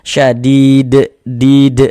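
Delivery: "Syadid" chanted, then "did" bounced loudly off the d; qalqalah recitation. Shown as a demonstration. ("Syadid" dilantunkan dengan pantulan)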